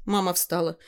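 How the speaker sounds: ordinary delivery, nothing marked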